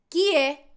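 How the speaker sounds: "Chi è" as kee-eh; Italian pronunciation. Shown as a demonstration. Teacher drilling "who is it", "Chi è" with k. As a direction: say "Chi è" with a hard k, clearly. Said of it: "todos los ejemplos sin interrupciones".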